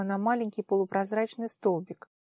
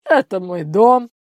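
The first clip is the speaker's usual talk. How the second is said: gruff man voice; 13 dB louder, slower than usual. Acted. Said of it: "ответил мужской голос".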